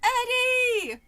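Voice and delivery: high, loud shout of the name; frightened call. A narrator's reading. Sister calls for her vanished brother, frightened shout. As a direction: call out in rising alarm. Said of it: "My voice grew higher and more frightened".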